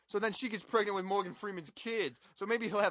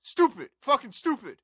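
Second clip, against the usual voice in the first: high, loud disgusted shout; angry outburst; 7 semitones higher, 11 dB louder than usual. Real, not acted. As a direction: spit it out in disgust. Angry rant on movie.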